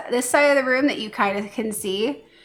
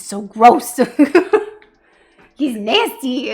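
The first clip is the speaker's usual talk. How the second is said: loud laughing disgust; 4 semitones higher, 8 dB louder, slower than usual. Real, not acted. Laughing about her messy dog eating mousse. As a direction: laugh through the words.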